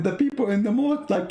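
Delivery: usual speaking voice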